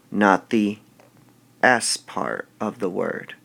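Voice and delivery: normal talking voice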